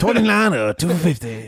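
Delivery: high cartoon salesman; Mister Allen. Mimicking a commercial's price offer, high cartoon salesman. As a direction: sing out the deal in a high pitchman voice. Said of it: "I just like the ten bucks".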